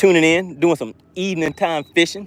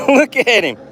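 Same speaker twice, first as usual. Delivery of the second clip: louder, higher look at him; excited; 10 semitones higher, 5 dB louder than usual. Real, not acted. Angler to viewers, excitedly showing the fish. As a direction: show off the fish with a burst.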